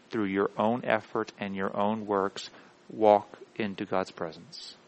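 slow, low, measured declaration; solemn preaching. Real, not acted. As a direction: lay down each phrase softly and deliberately.

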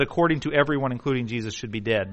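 ordinary talk, nothing marked

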